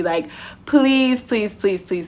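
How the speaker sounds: normal talking voice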